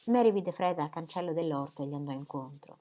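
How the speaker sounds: usual speaking voice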